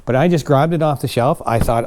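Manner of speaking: normal talking voice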